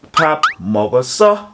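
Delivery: slow "Pap mogoso", going up at the end; rising question intonation. Shown as a demonstration. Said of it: "with the rising intonation going up".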